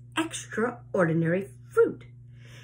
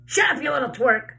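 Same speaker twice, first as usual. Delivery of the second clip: loud, higher angry snap; snapping; 6 semitones higher, 6 dB louder, faster than usual. A narrator's reading. Aunt Spiker snaps at James for overhearing, loud and sharp. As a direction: snap it at the boy. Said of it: "Aunt Spiker snapped".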